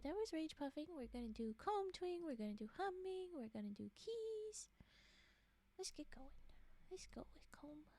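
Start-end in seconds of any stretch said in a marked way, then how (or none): 5.8-7.9 ASMR whisper; soft ASMR whisper to chat. Real, not acted. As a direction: confide it in a breathy hush.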